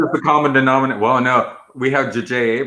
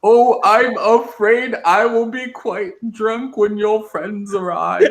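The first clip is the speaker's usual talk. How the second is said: high, slow, cackling Emperor; 10 semitones higher, slower than usual. Pretend. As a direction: gloat like a drunk Sith lord.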